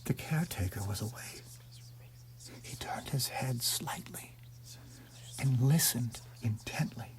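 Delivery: hushed unvoiced whisper; tense whispered narration. A narrator's reading. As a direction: breathe it out, barely a sound.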